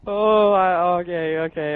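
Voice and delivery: scared horror game streamer. Real, not acted. After scare.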